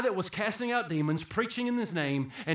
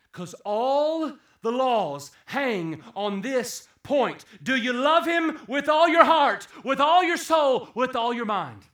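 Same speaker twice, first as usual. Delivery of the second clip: higher and louder, slow and insistent; preaching at full force; 8 semitones higher, 11 dB louder, slower than usual. Acted. Preacher presses the congregation with a rising, loud call. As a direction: drive each phrase home to the pews.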